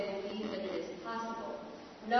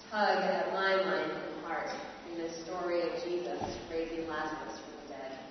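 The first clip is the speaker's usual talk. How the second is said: ordinary talk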